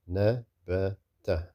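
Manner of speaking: "Nabata" slowly, as three short separate syllables; short syllables, separated. Shown as a demonstration. Teacher spells out short sounds for learners, syllable by syllable. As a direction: break "Nabata" into na, ba, ta with gaps. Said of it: "these are short sounds".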